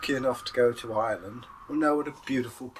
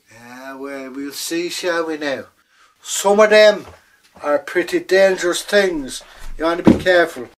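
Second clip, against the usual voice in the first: warning leprechaun; 4 semitones higher, 8 dB louder than usual. Acted. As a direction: warn her with relish. Leprechaun warns ominously.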